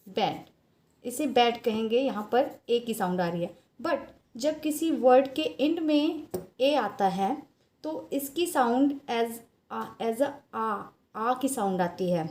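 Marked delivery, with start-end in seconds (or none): none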